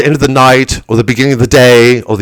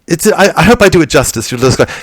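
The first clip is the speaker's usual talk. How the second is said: ordinary delivery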